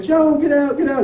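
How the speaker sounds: high-pitched mock yell; retold panicked scream, pitched well up; imitating screaming mom. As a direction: call out like a frantic mother, half-shouted. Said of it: "my mom's running around screaming".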